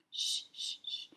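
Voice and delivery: soft unvoiced hushing; whisper. A narrator's reading. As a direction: hush the scared kids in a whisper. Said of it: "Stonewall Jackson whispered".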